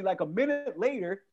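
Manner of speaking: ordinary talk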